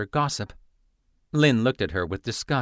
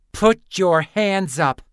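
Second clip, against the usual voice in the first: slow, loud snarled command; furious slow snarl, hands up; 8 semitones higher, 6 dB louder, slower than usual. Acted. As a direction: drag each word out as a threat. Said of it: "Lynn snarled".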